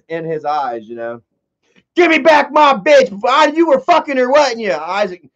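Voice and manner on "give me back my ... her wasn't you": high, loud accusing yell at the door; angry intruder. Retold or imitated. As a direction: demand your woman back, furious.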